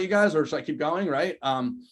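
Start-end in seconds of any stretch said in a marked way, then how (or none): none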